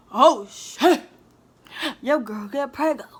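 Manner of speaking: loud, startled 'oh shit' then teasing herself; shocked outburst. Retold or imitated. Retelling her shock at five positive tests, loud outburst. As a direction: burst out in disbelief at the result.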